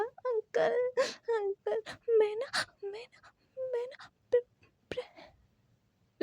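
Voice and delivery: sobbing, halting pretend confession; stammering mock sobs; fake sobbing. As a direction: choke on every word through fake tears. Wing keeps fake crying.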